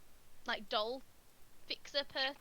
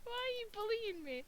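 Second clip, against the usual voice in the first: mock whine; 10 semitones higher, slower than usual. Mock whine at teasing colleague.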